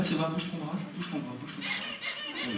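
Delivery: slow, hushed coaxing with a laugh; soft coaxing. Acted. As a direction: gently coax the child. Coaxing child to move arm.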